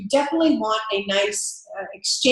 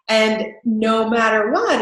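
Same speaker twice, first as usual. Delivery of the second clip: ordinary talk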